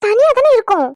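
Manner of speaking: sharp, high, loud retort; exasperated wife shouting back at her husband; angry retort. Acted. Wife snaps back in quarrel.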